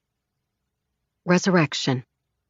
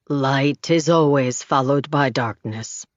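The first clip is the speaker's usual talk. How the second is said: low, unhurried wise man; easy, knowing man; 6 semitones lower than usual. A narrator's reading. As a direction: say it like old wisdom. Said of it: "he says easily".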